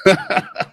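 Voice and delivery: short high-pitched laugh; real laugh. Real, not acted. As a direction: burst of amused laughter. Laughs at his own mockery.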